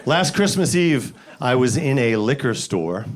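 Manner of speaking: normal talking voice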